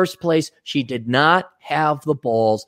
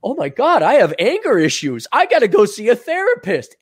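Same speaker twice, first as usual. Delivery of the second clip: higher and a bit louder, hammed-up worry; mock panic; 8 semitones higher, 3 dB louder than usual. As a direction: panic like a naive young guy. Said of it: "I would have thought".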